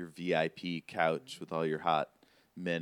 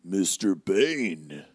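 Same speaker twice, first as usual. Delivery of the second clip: giant's booming whisper; 5 dB louder than usual. Acted. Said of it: "whispers into the earpiece".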